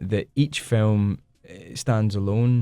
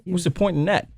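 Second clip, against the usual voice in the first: higher, a light New York accent on "What's the point in that?"; 8 semitones higher than usual. Shown as a demonstration. Trying a New York accent when the host asks for it. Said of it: "I don't really do a New York accent".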